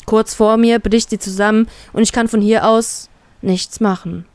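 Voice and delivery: ordinary delivery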